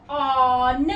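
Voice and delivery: "ऑन" drawn out with open "aw", then the n; closed-syllable short O. Shown as a demonstration. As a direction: sound out "ऑन" slowly, closing on n. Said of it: "ये देगा अपनी short sound". ("ऑन" को धीरे, खुले "ऑ" के साथ)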